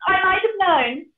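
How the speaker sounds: high, loud wailing pig; crying piggy. Acted. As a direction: wail it like a terrified piglet. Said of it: "Pig cried".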